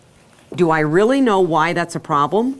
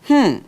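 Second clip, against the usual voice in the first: high, loud musing hmm; a pondering hum up high; thinking hmm; 7 semitones higher, 4 dB louder than usual. Shown as a demonstration. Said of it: "you immediately go into".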